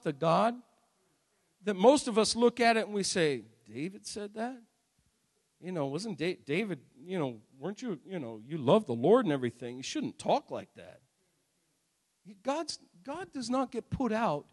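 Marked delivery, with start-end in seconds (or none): 3.7-11.0 low, soft mock reproach; hushed disapproval. Churchgoers chiding David for his prayers, hushed disapproval. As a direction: chide quietly, as if taken aback.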